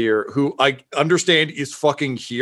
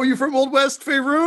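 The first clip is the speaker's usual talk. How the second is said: lightly voiced Old West twang, pitched up; Old West accent; 12 semitones higher than usual. A hint of an Old West accent as a character greets a fellow dwarf. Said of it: "that's an accent I know".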